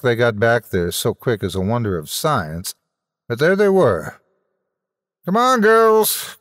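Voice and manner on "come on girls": high, loud, slow rallying cry; rally the troops in a high loud drawn-out cry; cried out. Acted. Quelch rallying his men before the charge, high loud cry. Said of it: "cried Quelch".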